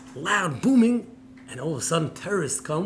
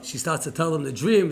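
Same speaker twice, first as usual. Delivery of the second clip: ordinary talk, nothing marked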